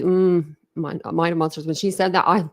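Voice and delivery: ordinary talk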